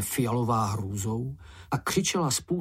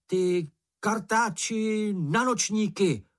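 taunting insult; 9 semitones higher, slower than usual. A narrator's reading. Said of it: "pokračoval".

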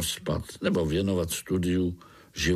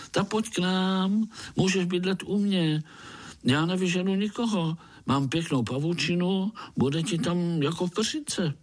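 much higher, eager offer; eager invitation; 8 semitones higher than usual. A narrator's reading. Pája offers homeless Artur his web, eager. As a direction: pitch up high, welcoming with a sudden idea. Spider happily offers a home.

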